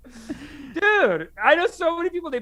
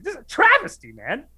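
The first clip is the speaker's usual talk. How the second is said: mock outrage; 6 semitones higher, 5 dB louder than usual.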